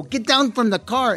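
put-on Chicano aunt accent. Actor imitating the tías he learned from, Chicano accent. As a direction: bark it like a Chicano aunt scolding. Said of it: "that kind of Chicano accent".

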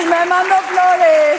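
high, slow, loud mock delight; mock gushing. Friend teasing about self-sent flowers, mock gushing. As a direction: gush it, stretching the words high.

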